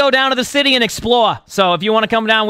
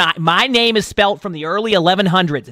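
ordinary delivery

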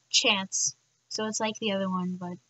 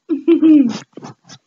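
loud laugh; 3 semitones higher, 13 dB louder than usual. Real, not acted.

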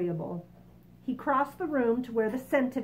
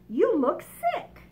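grasshopper voice; 9 semitones higher, 7 dB louder, slower than usual. Acted. Grasshopper continues.

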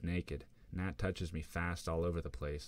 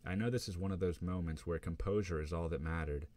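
plain reading voice

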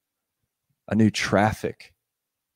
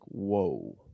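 awed whoa; 5 semitones lower, 8 dB quieter than usual. Acted. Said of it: "Nathanael is like".

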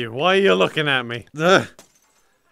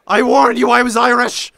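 loud boastful Irish-ish brawler taunt; hammed-up fighter's war cry; mock Irish taunt; 5 semitones higher, 7 dB louder than usual. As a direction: yell it like a pub brawler. Brawling game character taunt.

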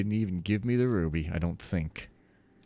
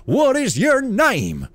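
loud, slow, high ringing question; booming divine lord; 14 semitones higher, 9 dB louder, slower than usual. Acted. Builder questioning the poor novice, booming voice. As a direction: ask it like a thundering god.

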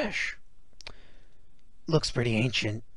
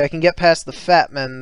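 usual speaking voice

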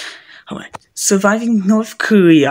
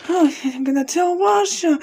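ordinary delivery

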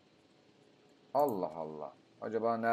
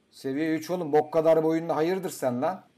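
loud, higher taunting jeer; mock taunt; 6 semitones higher, 8 dB louder than usual. Real, not acted. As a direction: talk trash at the little dinosaur. Taunting a dinosaur on screen. (küçük dinozora yüksek sesle dalga geçen çıkışma)

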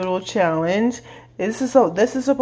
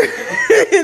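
squealing laugh, pitched way up; high cackling laugh; 13 semitones higher than usual. Real, not acted. Friend laughing at the pepper eater: high cackle.

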